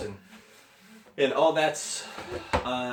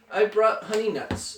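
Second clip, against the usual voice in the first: lightly voiced badger, pitched higher; grinning badger; 7 semitones higher than usual. A narrator's reading. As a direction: offer it with a grin in the voice. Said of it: "badger says with a grin".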